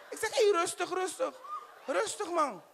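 high-pitched pleading to calm down. Acted. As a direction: hush your friend, pitched high. Calming the angry friend. (hoog en sussend, rustig maar)

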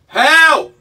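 very loud, high, drawn-out yells; shouting for help. Real, not acted. Trapped in a lift calling rescuers, shouting. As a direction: yell to be heard through the walls.

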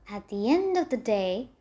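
plain reading voice

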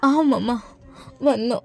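answering through tears, voice falling quiet; girl tearfully tells grandma she's back; tearful reply. Acted. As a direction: answer grandma while crying softly.

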